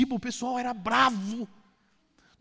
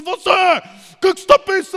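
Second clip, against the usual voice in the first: loud, higher mock yell at a teammate; mock angry yell; 7 semitones higher, 8 dB louder than usual. Teammate yelling at the pastor over a missed ball, mock angry. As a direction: bark it like an annoyed player on the pitch. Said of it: "o cara vinha e falava assim".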